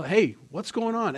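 a mild mock yell at squabbling kids. Dad calling to the kids in the other room, mock yell. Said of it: "you just have to yell".

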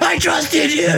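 very high, strained imitation of betrayal; mock betrayed wail. The stick seller snapped in half by voodoo, mock betrayed wail. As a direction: wail it in a hammed-up falsetto.

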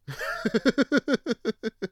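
high rapid chuckle; laugh. Real, not acted. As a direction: chuckle in a long run of quick pulses. Laughs after his own remark.

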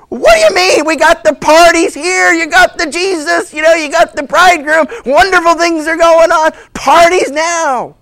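loud, high, exuberant party host; Jesus. Acted. Jesus telling gloomy believers the party is here, exuberant. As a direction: shout it out, thrilled and incredulous.

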